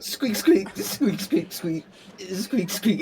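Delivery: rat squeaks. Retold or imitated. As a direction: squeak at the rats. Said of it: "rats, like".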